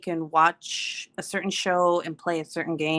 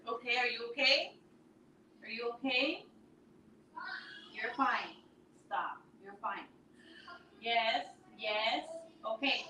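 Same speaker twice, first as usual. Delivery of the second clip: far off mic; 7 semitones higher, 12 dB quieter than usual. Real, not acted. Mother calming her angry son in another room, far off mic.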